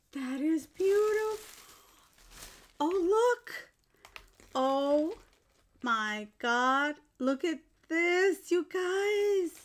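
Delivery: high, loud, overwhelmed delight; real excitement. Real, not acted. Showing viewers the handmade gift, overwhelmed excitement. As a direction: gush loudly, pitched right up. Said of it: "I'm so excited".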